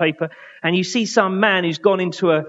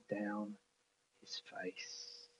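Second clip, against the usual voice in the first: whisper; 6 semitones lower, 25 dB quieter, much slower than usual. Real, not acted. Preacher picturing tears on Jesus' face, whispered. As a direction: breathe the words out in a whisper.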